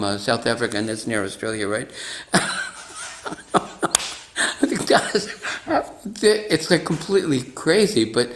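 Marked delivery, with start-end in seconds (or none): 2.3-8.0 real laugh breaking into the words; giggling through it. Real, not acted. Laughing at her own story.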